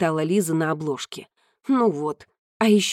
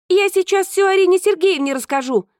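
loud high threat to tell; 11 semitones higher, 3 dB louder than usual. Acted. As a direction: call it out loudly. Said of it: "пообещала Лиза громко".

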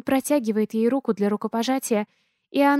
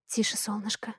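hushed, much softer plea; whisper; 9 dB quieter than usual. A narrator's reading. Mother hushes her daughter, whispering. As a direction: shush the child gently. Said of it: "шепчу".

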